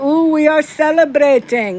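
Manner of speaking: mocking fake Arabic accent. Mimicking Pam's live to the guys in a fake Arabic accent. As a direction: parody her cheesy made-up Arabic accent. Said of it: "with that weird ass, um, weird ass accent".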